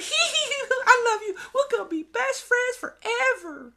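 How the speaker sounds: put-on very high, giggling little boy voice; giggly little kid. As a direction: squeal it out like a giggling toddler. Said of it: "and he's like".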